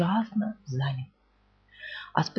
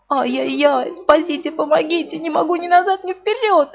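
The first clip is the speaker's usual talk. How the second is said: much higher, desperate wail; 8 semitones higher than usual. A narrator's reading. Trapped Pooh wails desperately, much higher. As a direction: wail in despair, stuck fast. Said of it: "завопил отчаянным голосом".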